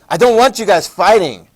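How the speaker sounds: loud, high, commanding ruler; a strongman forbidding any fighting; stern dictator Tito. Retold or imitated. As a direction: lay down the law to squabbling nations. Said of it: "He's like".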